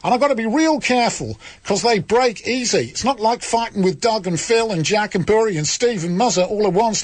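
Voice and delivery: a hammed-up, over-the-top Australian accent. Host reading a listener's letter to radio listeners, in a bad Australian accent. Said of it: "This needs an Australian accent".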